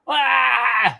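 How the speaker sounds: loud, high wordless wail; imitated baby scream. Showing how babies communicate before words, imitated scream. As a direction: cry out like a baby without words. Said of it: "by screaming".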